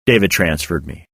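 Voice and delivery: ordinary delivery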